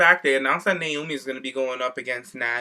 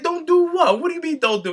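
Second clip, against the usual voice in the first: put-on much higher, baffled voice for the older coworker; mock confused older coworker; 12 semitones higher than usual. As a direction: ham up the clueless older man who doesn't get the slang. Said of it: "He's like".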